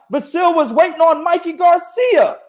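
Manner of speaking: emphatic shout. Real, not acted. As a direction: hammer the point home. Heated argument point.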